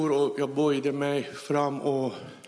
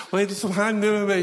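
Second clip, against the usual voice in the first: laughing, higher, louder, quick amazed question; 6 semitones higher, 4 dB louder, faster than usual. Retold or imitated. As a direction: burst out astonished. Overwhelmed by what happens. (förvånat, högre och snabbt)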